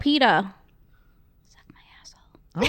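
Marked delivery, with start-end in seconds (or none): 1.5-2.7 soft whispered insult. Real, not acted. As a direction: whisper it like a private jab. Whispered swear aimed at PETA.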